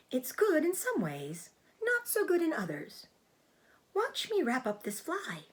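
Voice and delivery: a small spider explaining herself calmly; Charlotte the spider. Acted. Narrator voices the spider Charlotte.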